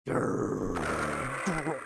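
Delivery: a low mock tiger growl from the throat. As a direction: growl like a big cat.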